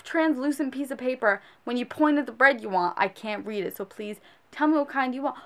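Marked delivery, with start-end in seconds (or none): none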